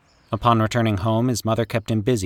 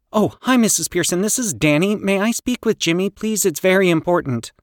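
anxious polite; 11 semitones higher, 4 dB louder than usual. Acted. Danny anxious on phone.

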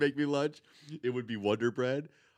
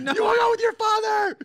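very high, loud shout; mock furious mom; 11 dB louder than usual. Mom accusing kid over dad, mock furious shout. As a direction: shriek the accusation like an outraged mother.

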